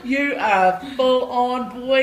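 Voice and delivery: slow, loud, high booming praise; hyped praise. Retold or imitated. To a struggling student, loud slow booming praise. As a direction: pump the kid up like a million bucks.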